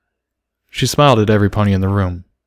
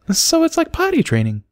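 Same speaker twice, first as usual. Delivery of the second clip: put-on much higher small child voice; a shy little filly confessing; little foal voice. A narrator's reading.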